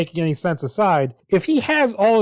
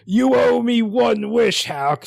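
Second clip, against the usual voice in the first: mock yell; 4 semitones higher, slower than usual. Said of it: "Snake yells at him going".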